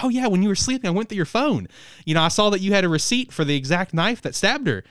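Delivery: mock-sweet accusation; lightly voiced eager girl. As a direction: tease him like a giddy detective. Voicing the stalker detective.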